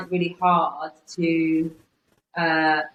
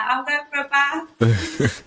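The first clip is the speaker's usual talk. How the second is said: slow, higher Bristolian "bath" with a broad Bristol vowel; Bristolian accent; 8 semitones higher, slower than usual. Shown as a demonstration. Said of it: "when I say things like grass and bath, like, you can, I think you can tell".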